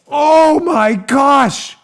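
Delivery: very slow, stretched mock horror; hammed-up shock. Host plays Rosenstein stunned by the dossier, hammed-up shock. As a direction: draw out every word in fake astonishment. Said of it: "he's gotta be dramatic too".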